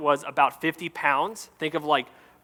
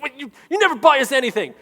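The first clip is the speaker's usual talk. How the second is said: put-on grumpy kid voice; 10 semitones higher, 4 dB louder than usual. Grumpy daughters complaining.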